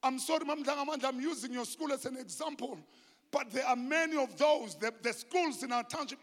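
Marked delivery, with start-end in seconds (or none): none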